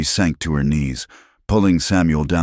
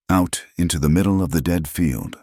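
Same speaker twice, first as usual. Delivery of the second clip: plain reading voice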